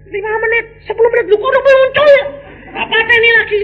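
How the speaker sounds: high, fast, exasperated complaint; mock impatience. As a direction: rush it high and loud. Impatient husband waiting.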